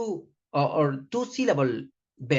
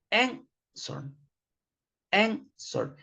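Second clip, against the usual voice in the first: Answer, high stressed AN then falling; first-syllable stress; 6 semitones higher, much slower than usual. Shown as a demonstration. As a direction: model Answer stressed at the start. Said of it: "you say answer".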